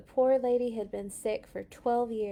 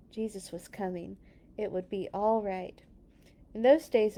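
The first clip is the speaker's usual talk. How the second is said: usual speaking voice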